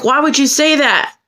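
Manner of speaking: high-pitched indignant challenge; pissed off. Retold or imitated. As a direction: demand an answer, appalled. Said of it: "I was pissed".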